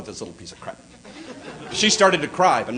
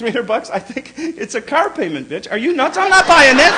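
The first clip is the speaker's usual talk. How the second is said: mock outrage; 6 semitones higher, 5 dB louder than usual. Husband outraged at the price.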